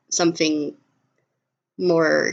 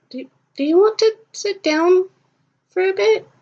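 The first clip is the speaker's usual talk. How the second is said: much higher, tentative question; awkward, hesitant offer; 11 semitones higher than usual. Acted. Sammy awkwardly invites Tally to sit by the fountain, hesitant and high. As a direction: offer it haltingly, pitched up.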